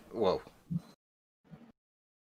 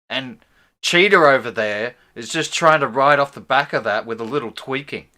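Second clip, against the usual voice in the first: ordinary delivery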